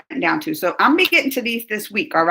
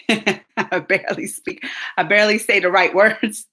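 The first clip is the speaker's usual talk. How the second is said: laughing at herself; 2 semitones lower than usual. Real, not acted. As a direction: giggle at your own English.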